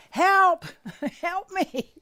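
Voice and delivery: loud, high, hammed-up plea to God; praying for help in a mock shout; desperate cry for help. Shown as a demonstration. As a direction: shout it up to the heavens. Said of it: "ask God".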